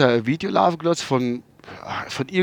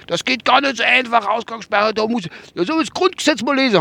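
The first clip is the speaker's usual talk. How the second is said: much higher driver voice; driver's voice; 8 semitones higher than usual. Retold or imitated. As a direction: quote the driver, pitched up. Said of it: "Hab ich gesagt".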